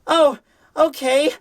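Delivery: slow, high, meek agreement. Acted. Nemu obediently agreeing to the surgery, slow and high.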